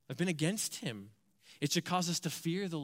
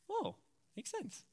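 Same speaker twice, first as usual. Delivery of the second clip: high breathy mock whoa; half-joking wow, pitched way up; mock amazement; 11 semitones higher, 9 dB quieter than usual. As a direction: play at being amazed.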